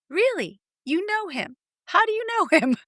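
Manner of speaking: high, incredulous challenge; mock disbelief. Coach challenging the client's certainty, high disbelief. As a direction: scoff up high. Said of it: "And I'll say".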